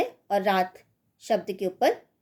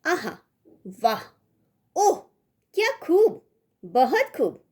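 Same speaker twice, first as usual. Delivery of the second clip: high, loud cry of praise; bright burst of admiration; admiring exclamation; 7 semitones higher, 3 dB louder than usual. Shown as a demonstration. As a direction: sound delighted and impressed. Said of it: "हर्षबोधक या प्रशंसा के मनोभाव".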